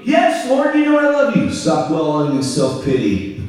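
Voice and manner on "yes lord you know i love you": pleading answer. Acted. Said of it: "Peter insists".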